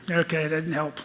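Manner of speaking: usual speaking voice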